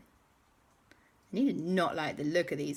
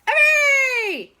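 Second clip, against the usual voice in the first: very high, loud hooray; jubilant cheer; 13 dB louder than usual. Acted. Everybody celebrating the villains' defeat, jubilant cheer. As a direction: cheer at the top of your voice.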